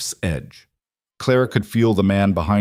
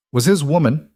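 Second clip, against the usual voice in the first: sharp; 6 semitones higher than usual. A narrator's reading. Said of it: "Clara said sharply".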